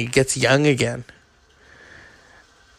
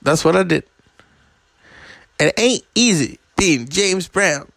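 lightly voiced [name], a bit higher; a hint of [name]'s rasp and swagger; raising the pitch for a loose [name]; [name] impression; 6 semitones higher than usual. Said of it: "he says to Clive Owen".

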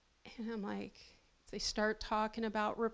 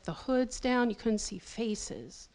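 normal talking voice

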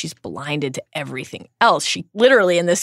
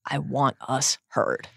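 quiet, slow and softer; softly; 4 semitones lower, 6 dB quieter, slower than usual. A narrator's reading. Hermione answering Harry softly. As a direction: say it slow and low under your breath. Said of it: "she says softly".

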